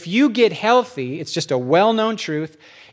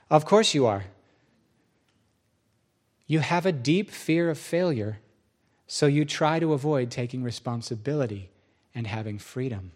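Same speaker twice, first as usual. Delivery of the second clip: low, soft, measured diagnosis; calm God; 4 semitones lower, 4 dB quieter than usual. Acted. God tells the preacher his deep fear, calmly. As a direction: lower and soften as it goes on.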